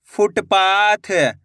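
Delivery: slow, drawn-out blending of the word; slow clear reading. Shown as a demonstration. Teacher to pupils, blending the word slowly. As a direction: stretch each syllable for the pupils. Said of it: "एक एक अक्षर का उच्चारण सही से करना".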